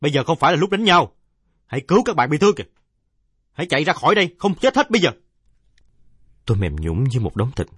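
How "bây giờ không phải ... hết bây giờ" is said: high, loud commanding shout; a stranger shouting at fighting boys to stop; shouted order. Acted. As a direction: bark it at the crowd over the chaos. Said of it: "quát to".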